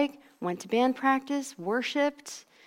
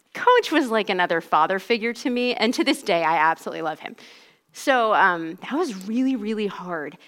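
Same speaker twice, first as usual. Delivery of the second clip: ordinary delivery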